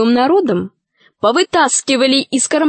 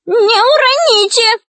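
high, slow witchy wail; anxious high-pitched plea; 12 semitones higher, 4 dB louder than usual. A narrator's reading. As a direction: lament in a high, drawn-out voice. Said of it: "и причитала".

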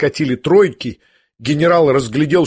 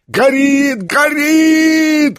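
very high, sustained cry; crowd's shout; 13 semitones higher, 4 dB louder, much slower than usual. A narrator's reading. Everyone rushing to the fireplace, shouting in alarm. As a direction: call it out high, holding the vowel. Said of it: "кричали все в один голос".

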